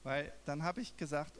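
ordinary talk, nothing marked

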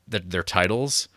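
normal talking voice

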